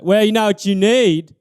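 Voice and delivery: loud pushy buddy voice; alcoholic buddy. Retold or imitated. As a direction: sell it like a know-it-all drinker. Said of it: "He's like".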